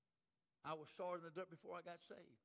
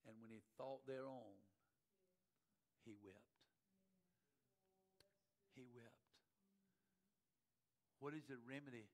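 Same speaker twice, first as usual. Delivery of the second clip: solemn altar appeal; 4 dB quieter than usual. Real, not acted. Preacher calling congregation to weep at altar, solemn appeal. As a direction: bring it down to a hushed, earnest plea.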